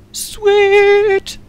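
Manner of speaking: high, loud cry of triumph; triumphant squeal. Real, not acted. Gamer celebrating the solved puzzle with a high squeal. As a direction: shriek it in victory.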